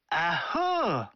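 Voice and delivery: アホー drawn out slowly, teasing and coy; mock scolding, playful and slow; playful scolding woman. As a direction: swat back at the flirting customer with a long アホー. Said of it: "と客の背中をどついたりする".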